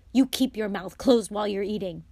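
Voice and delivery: loud, sharp reprimand; snapping. A narrator's reading. Mother cuts Fred off at breakfast, snapping. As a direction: snap at him to shut up. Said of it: "Missus Weasley snapped".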